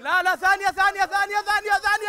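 excited loud countdown; shouting the count. Real, not acted. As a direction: yell out the seconds to hurry him. Counting seconds, urging a friend.

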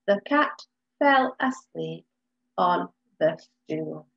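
"The cat fell asleep on the stool" word by word; slow, one beat per word; counting words. Shown as a demonstration. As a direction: tap out each word as you count. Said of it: "Let's count, um, how many words".

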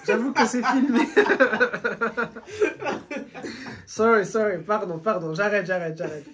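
laughing hard while saying sorry; real laughter. Real, not acted. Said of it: "C'est filmé et tout en plus". (rire franc en s'excusant)

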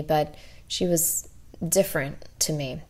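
usual speaking voice

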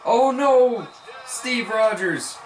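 very loud, high cry of dismay; a slow, drawn-out wail of the name; anguished shout. Real, not acted. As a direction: shout in real anguish as he misses. Defender just misses the play.

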